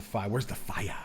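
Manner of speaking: hushed joking whisper; quiet mock-serious whisper. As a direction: whisper it like a secret. Joking whisper before a take.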